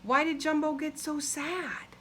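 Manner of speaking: ordinary delivery, nothing marked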